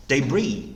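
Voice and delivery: "debris" as de-BREE, long final vowel; American stress on I. Shown as a demonstration. Teacher repeating American "debris" for students, stressed on the final -BREE. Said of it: "I에 강세를 둡니다".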